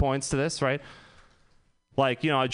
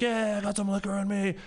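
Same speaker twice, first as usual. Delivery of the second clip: crowd cheering back; 7 semitones higher than usual. Retold or imitated. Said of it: "everyone's like".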